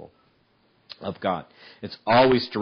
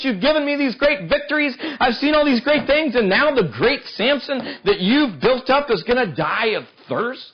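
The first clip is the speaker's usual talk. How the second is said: high, loud, near-breaking plea to God; desperate Samson; 13 semitones higher, 5 dB louder than usual. Acted. Samson, dying of thirst, pleads with God, high and breaking. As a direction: cry out like a hero at the end of his strength.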